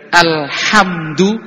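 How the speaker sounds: Alhamdu stretched very slowly, the ha held long; drawn-out alhamdu. Shown as a demonstration. Teaching the congregation the ha in Alhamdu, slowly drawn out. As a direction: spell out Alhamdu syllable by syllable, slow. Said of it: "Itu kan pakai ha".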